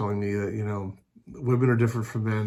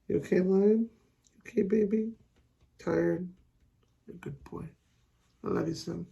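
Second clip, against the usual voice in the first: pet talk; 10 semitones higher than usual. Real, not acted. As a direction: coo tenderly at the sleepy dog. Cooing to the tired dog.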